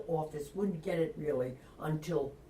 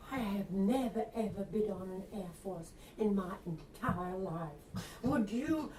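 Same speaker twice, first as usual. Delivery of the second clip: put-on Southern accent; 3 semitones higher than usual. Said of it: "you're using a Southern accent".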